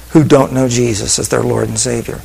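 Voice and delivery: usual speaking voice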